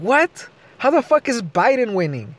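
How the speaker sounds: mock outrage at the news; incredulous. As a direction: act stunned that "Biden" could be winning. Retelling disbelief at news.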